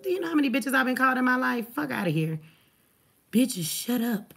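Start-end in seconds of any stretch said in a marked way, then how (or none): 3.3-4.3 slow, low, mocking readout of a commenter. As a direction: drag out "Bitches, shut up" to ridicule it. Reading out an insulting chat comment.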